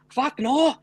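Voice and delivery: high-pitched imitated Scottish retort; indignant Scot, pitched well up; Scottish accent. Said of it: "talking in his Scottish".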